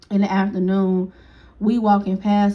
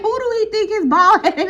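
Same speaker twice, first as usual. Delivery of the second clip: high, laughing outrage; laughing disbelief; 10 semitones higher, 3 dB louder than usual. Retold or imitated. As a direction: laugh through the question. Friend laughing about him.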